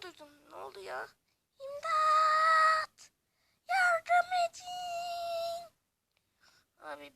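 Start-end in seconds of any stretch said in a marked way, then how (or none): 1.6-3.1 high, far-off shriek for help; high cry for help. Acted. Trapped villager calling to the players for help, shrieking. 3.7-5.7 high, far-off shriek for help; high cry for help. Acted. Trapped villager calling to the players for help, shrieking.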